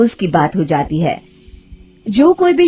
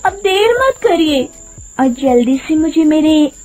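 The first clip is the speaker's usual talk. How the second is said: sweet coaxing woman; 7 semitones higher than usual. Acted. Witch in disguise coaxes Hariya.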